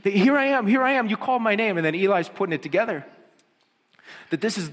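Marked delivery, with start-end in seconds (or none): 0.0-1.8 put-on eager boy. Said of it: "Samuel comes running into Eli and goes".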